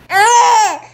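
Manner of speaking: sudden loud laugh; bursting into laughter at her own joke. Real, not acted. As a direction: let out a big laugh. Mother laughs loudly.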